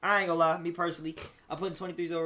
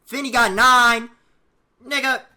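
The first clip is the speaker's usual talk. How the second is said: loud hype; 7 semitones higher, 12 dB louder, slower than usual. Real, not acted. Hyping the ranking to chat.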